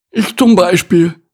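high, loud, grumpy pig voice; grumbling pig. A narrator's reading. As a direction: grumble loudly as an aggrieved pig. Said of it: "brummte Borsti".

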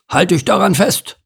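high, urgent order; commanding. A narrator's reading. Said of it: "befahl er Ingo".

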